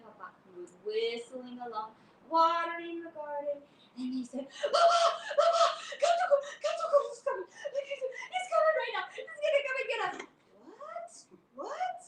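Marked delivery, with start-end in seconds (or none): 4.5-10.2 high loud breathless panic; panicked pleading. Acted. Children warning Papa the cat is coming, panicked pleading. 10.7-12.0 high rising baffled question; confused what. Acted. Papa puzzled by the kids' warning, confused.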